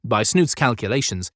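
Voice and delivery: ordinary delivery, nothing marked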